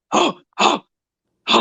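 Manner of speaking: short, rough barking grunts. Retold or imitated. Pig coming out of the pen, barking like [name]. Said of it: "he would bark like D M X".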